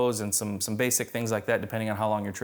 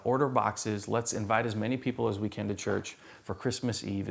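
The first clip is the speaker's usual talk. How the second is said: ordinary talk, nothing marked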